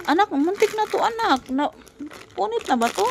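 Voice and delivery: suddenly high, aghast at the damage; mother's high dismayed yelp over the box; alarmed exasperation. Real, not acted. Child nearly tore the box.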